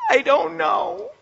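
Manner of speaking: mock sobbing, high and drawn out; crying wife. Wife answers husband who asks why she's crying, mock tearful. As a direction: imitate a tearful wail, stretching the words. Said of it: "my wife is just crying".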